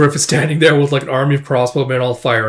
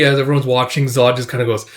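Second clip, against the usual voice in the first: ordinary delivery, nothing marked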